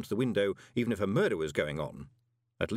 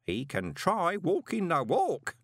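higher, slow, deliberate musing; scheming postman; 8 semitones higher, slower than usual. A narrator's reading. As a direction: savour the idea slowly in the postman's voice. Postman decides, talking to himself.

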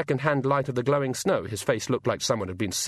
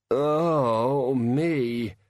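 groaning. A narrator's reading. Hungover boy groaning.

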